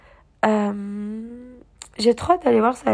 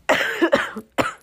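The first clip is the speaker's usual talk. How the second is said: short real cough; 6 semitones higher than usual. Real, not acted. Apologising to viewers while a bit sick, coughing. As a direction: cough briefly before carrying on. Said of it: "Désolée, je tousse un peu".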